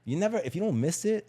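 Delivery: usual speaking voice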